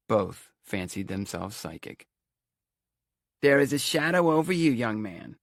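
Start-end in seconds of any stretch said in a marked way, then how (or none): 3.4-5.4 high, loud, theatrical Romany fortune-teller; ominous proclamation in a Romany accent; thick Romany accent. A narrator's reading. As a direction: pronounce it like a thirties horror-film seer, thick Romany accent. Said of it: "she talked in a thick Romany accent".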